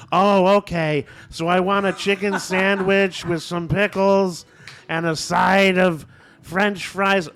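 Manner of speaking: slow, high, loud ordering holler; drive-through yelling. Retold or imitated. Friend yelling his order at a drive-through speaker, slow mock yell. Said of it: "yelling at the thing".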